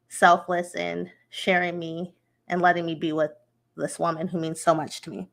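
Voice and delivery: ordinary delivery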